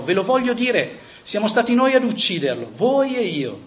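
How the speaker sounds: mild shout. Acted. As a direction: proclaim it to the crowd with force. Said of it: "gridò".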